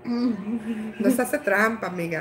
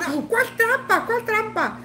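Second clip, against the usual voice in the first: much higher indignant mock denial; husband denying; 8 semitones higher, 3 dB quieter, slower than usual. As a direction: protest innocence in a put-on voice. Husband denies cheating in quarrel. (esposo que niega la trampa con voz mucho más aguda)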